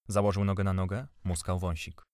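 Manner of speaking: ordinary delivery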